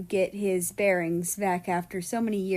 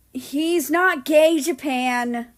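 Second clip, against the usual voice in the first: high, loud, slow protest; indignant denial; 6 semitones higher, 8 dB louder, slower than usual. A narrator's reading. America protesting to Japan, loud and indignant. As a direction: push back hard at the teasing.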